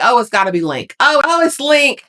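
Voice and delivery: high, thrilled squeal of hope; excited hope. Retold or imitated. Viewer imagining Link at the gate, high excited squeal. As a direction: leap up in pitch as if he's finally back. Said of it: "I'm like".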